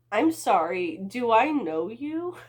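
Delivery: overly innocent deflection; playing innocent. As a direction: act like you've never met him. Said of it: "trying to play it off".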